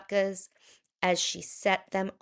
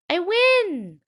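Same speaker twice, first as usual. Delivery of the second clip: put-on higher old grandmother voice; a sweet old lady lifted high; Bubba Braina; 12 semitones higher, 8 dB louder than usual. Old woman greeting her guest at the door, higher voice. Old baker wins game.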